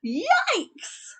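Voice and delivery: high shocked father yell; high alarmed yelp; yelled yikes. Acted. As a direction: yelp in horror at the cart. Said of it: "turned around and yelled".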